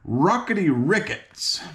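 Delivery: a high, startled outburst of annoyance; annoyed exclamation. A narrator's reading. King startled by the ringing bell, annoyed exclamation. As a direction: blurt it out, irritated. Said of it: "the king gave a start of annoyance and exclaimed".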